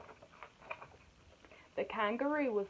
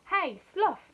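high, loud storybook call; 8 semitones higher, 8 dB louder than usual. A narrator's reading. Animals blaming Sloth, calling out loudly. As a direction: hail someone accusingly. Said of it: "they called".

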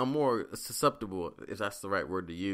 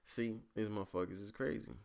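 much softer muttered see; muttered aside; 10 dB quieter than usual. Retold or imitated. After the lady walks off, softer muttered aside. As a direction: mutter it to himself. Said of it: "I was like".

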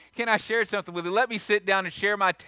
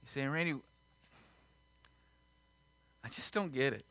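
hushed altar call; 4 semitones lower, 7 dB quieter than usual. Acted. Pastor inviting the bowed congregation to Jesus, hushed and tender. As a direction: draw them in quietly with heads bowed.

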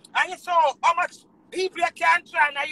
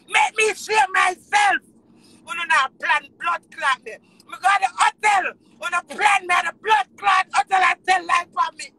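loud, raised shouting; real angry accusation; 2 semitones higher, 4 dB louder than usual. Real, not acted. Performer accusing them of plotting at hotel, angry. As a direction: hurl the accusation at full voice.

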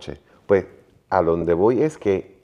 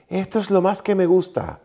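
dad's delight; 11 semitones higher than usual. Retold or imitated. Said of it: "y hace esto".

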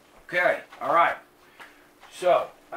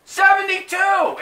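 yelled number; 14 semitones higher, 11 dB louder than usual. Retold or imitated. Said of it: "I yelled out".

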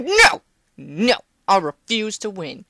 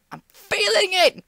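drawn-out hype; 10 semitones higher, 3 dB louder than usual. Real, not acted.

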